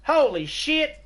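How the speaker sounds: high, loud shocked yelp; shocked exclamation. Retold or imitated. Groom shocked by his bride, yelping high and loud. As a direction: yelp it out in horror. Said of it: "And he's like".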